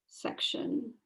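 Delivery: ordinary talk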